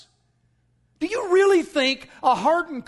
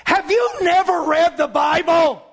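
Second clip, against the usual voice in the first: loud, high, exasperated rebuke; indignant shout; 7 semitones higher, 5 dB louder than usual. Shown as a demonstration. Preacher showing another way Jesus could say it, indignant shout. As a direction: shout it at them, hitting every word. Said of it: "could have said it like this".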